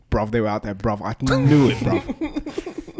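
loud, insistent dog in a mock British accent. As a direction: protest indignantly as a British bruv. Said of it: "he'd have a British accent".

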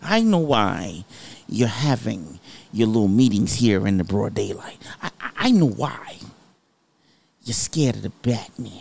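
slow, deliberate Joker impersonation; Heath Ledger. Retold or imitated. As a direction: imitate the Joker, drawn out with pauses. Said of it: "it says Heath Ledger".

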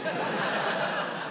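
high breathy laugh. Real, not acted. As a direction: burst out laughing. Laughs at the answer.

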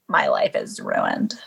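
slow, very low creaky mock despair; mock woe. Imagining the woman's complaint about her scandal, mock woe. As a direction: drag it out low as a pretend victim. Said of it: "but she'll be like".

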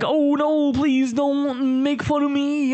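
whiny listener. Retold or imitated. Fan voiced as whining victim.

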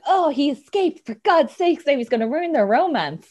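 exasperated. Retold or imitated. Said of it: "but I'm just so like".

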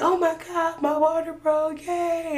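high, gleeful my water broke yay; thrilled. Retold or imitated. Mother telling her husband her water broke, thrilled. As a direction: shout it for joy.

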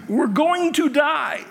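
hammed-up fear in the boat; mock panic. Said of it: "they're all freaking out in the boat".

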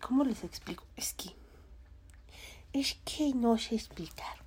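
thinking aloud, hushed; whispered fumbling. Real, not acted. Vlogger struggling to explain the anime, whispering. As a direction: whisper "¿cómo les explico?" to herself.

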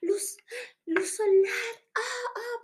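quejidos agudos y entrecortados, imitados; dolor por la luz. Retold or imitated. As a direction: exclamar encandilada, exagerando el dolor. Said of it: "me dolieron los ojos". (voz aguda de encandilada)

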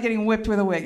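usual speaking voice

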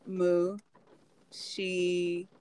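usual speaking voice

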